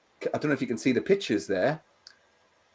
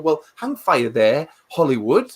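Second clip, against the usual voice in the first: mock telling-off, louder and higher on in the fucking movie; indignant rant at Hollywood; 6 semitones higher, 3 dB louder than usual. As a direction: scold Hollywood like a fed-up fan. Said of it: "And I'm thinking".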